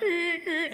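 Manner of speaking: high thin squeaking eek; squeaky rope creak. Retold or imitated. Preacher mimicking the creaking rope, high squeaks. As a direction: squeak like a rope lowering a man. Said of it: "I was like".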